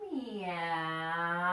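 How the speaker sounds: long meow gliding downward; drawn-out cat call. Pretend. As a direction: stretch it out like a cat. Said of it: "A cat says".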